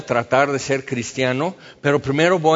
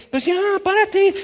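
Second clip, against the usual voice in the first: much higher, louder mock jeer; mocking taunt; 4 dB louder than usual. Mockers jeering at the paralyzed man, high taunt. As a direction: sneer it like a crowd taunting a cripple. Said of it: "entonces van a decir".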